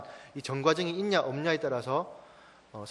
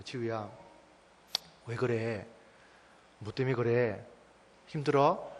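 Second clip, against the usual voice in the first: gentle questioning; 4 semitones lower, slower than usual. Retold or imitated. Father asks his crying daughter what is wrong, gently. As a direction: coax the child gently, pausing after each question.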